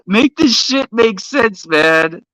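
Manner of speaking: incredulous laugh. Real, not acted. Laughing in disbelief mid-rant.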